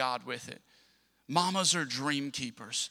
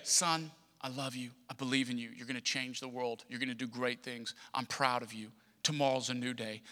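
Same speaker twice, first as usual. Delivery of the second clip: tender mother; 4 semitones lower, 5 dB quieter than usual. Retold or imitated. Mother's bedtime blessing retold.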